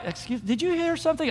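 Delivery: high, soft mock-innocent question; feigned ignorance. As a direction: play dumb in a small high voice. Acts soldiers pretending not to hear.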